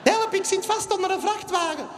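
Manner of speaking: high squeaky help call; falsetto cry for help. Acted. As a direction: squeal for rescue. Victim calling for help.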